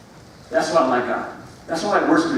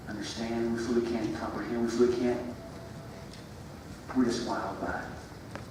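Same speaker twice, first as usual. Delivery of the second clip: soft, reverent musing prayer; hushed prayer; 5 dB quieter than usual. Acted. Preacher prays softly before the congregation, hushed and reverent. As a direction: breathe the words out in quiet awe. Quiet worshipful prayer refrain.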